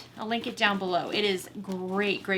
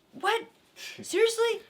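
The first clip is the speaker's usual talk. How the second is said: incredulous; 11 semitones higher than usual. Retold or imitated. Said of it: "you're like".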